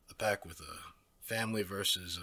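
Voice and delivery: normal talking voice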